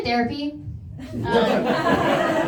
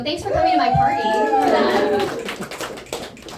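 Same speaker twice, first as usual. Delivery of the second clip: mock cheery thanks; 4 dB louder, slower than usual. Ironic thanks, much higher.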